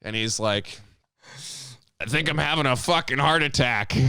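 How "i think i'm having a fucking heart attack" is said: higher panicked trucker. Retold or imitated. Trucker voiced in story.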